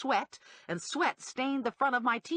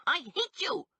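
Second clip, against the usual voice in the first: mild shout; 8 semitones higher than usual. A narrator's reading.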